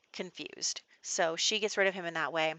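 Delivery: ordinary delivery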